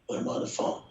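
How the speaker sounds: raspy whispered voice, hushed and breathy. Retold or imitated. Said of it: "You do a great imitation of [name]".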